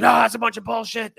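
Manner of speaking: high-pitched imitated outburst; mock angry shout. Coach rejects husband's accusation, mock angry shout. Acted reply in retold argument.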